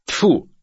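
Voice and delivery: high, loud "tfu"; disgusted spitting noise. Acted. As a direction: spit it out in disgust. Husband spits in disgust. (презрительный плевок)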